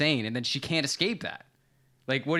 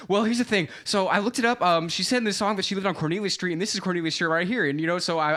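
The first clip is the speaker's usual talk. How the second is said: psychopathic rant; 3 semitones higher than usual. Retold or imitated. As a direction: rattle it off like an obsessed fan. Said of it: "psychopathic rant, like, uh, like, a hundred miles per".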